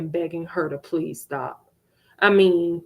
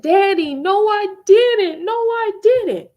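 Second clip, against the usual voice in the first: put-on high, loud, childlike pleading; pleading child; 12 semitones higher, 6 dB louder than usual. Little girl pleading with her father, high and loud.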